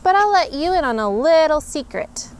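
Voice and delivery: usual speaking voice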